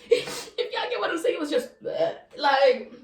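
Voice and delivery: laughing. Real, not acted. Laughing while describing the sloth.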